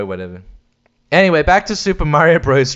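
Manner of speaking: ordinary talk, nothing marked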